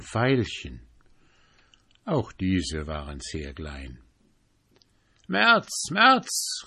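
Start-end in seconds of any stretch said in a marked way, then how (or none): 5.3-6.6 much higher rising calls; calling out. Acted. April calling after March, high teasing calls. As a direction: call after him teasingly. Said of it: "rief ihm der Vierte nach".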